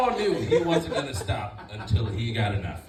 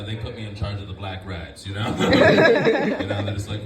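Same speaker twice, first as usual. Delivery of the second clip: ordinary talk, nothing marked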